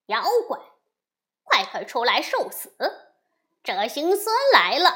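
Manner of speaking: furious shouted taunt; loud cursing. A narrator's reading. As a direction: bellow the dare at the demons. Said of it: "高声骂道".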